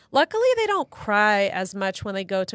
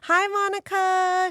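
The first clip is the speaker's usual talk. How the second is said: high, drawn-out friendly hello; sing-song greeting; 11 semitones higher, 4 dB louder, slower than usual. Real, not acted. Greeting a listener on air.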